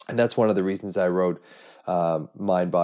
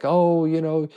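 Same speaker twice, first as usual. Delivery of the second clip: higher, louder retelling of a mother's tearful plea; a touch of teary guilt-trip, pitched up and louder; mock tearful plea; 6 semitones higher, 4 dB louder than usual. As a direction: imitate a mother guilting her son through tears. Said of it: "getting upset and tearful".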